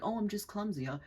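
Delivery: high-pitched mock innocence; innocent one. Culprit casting themselves as the innocent one, mock innocent. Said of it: "innocent one who's like".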